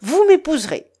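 loud, high, emphatic decree; commanding. A narrator's reading. Pirate orders the young woman to marry him, commanding. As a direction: impose it as an order.

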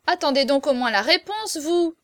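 loud, high protesting call; calling after someone. A narrator's reading. Berrichon running after the page, calling out.

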